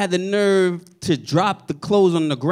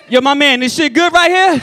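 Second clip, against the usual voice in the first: asking a stranger; 5 semitones higher, 5 dB louder than usual. Retold or imitated.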